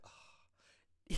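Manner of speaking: unvoiced satisfied groan; mock relieved groan; breathy relieved ugh. [name]'s relief.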